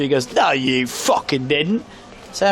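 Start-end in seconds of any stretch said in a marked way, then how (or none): 0.3-1.8 put-on slow, drunk contradiction; imitated drunk local. Drunk local disputes visitor, slow mock indignation. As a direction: drag it out like a drunk stranger. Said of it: "you're drunk now".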